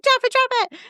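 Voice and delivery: high, urgent command without real volume; retold yelling. Owner ordering the dog to drop the possum, mock yelling. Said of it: "then I'm yelling at her".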